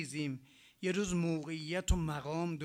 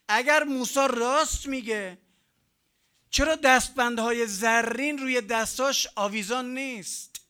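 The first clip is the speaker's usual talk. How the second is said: higher, louder boastful king; shouting Pharaoh; 4 semitones higher, 8 dB louder than usual. Retold or imitated. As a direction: bellow like a proud tyrant to his people. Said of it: "خود فرعون میگه".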